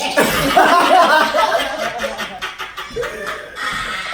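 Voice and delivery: high, loud burst of laughter; cracking up hard. Real, not acted. Big laugh at the joke.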